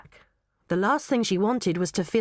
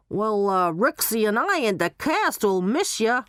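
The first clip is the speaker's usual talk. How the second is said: Barry the showman; 4 semitones higher than usual. Acted. Barry telling Molly the cast will miss her, put-on showman voice. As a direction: play Barry on the phone.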